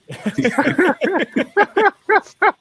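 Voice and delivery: high squealing burst of laughter; real laughing fit; shrieking laugh. Real, not acted. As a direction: crack up helplessly. Laughing after jokes.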